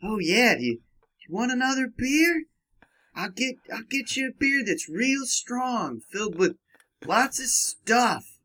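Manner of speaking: high, lightly voiced seductive bartender; a hint of a flirty barmaid offering beer; sexy lady voice. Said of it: "I was doing a sexy lady voice right there".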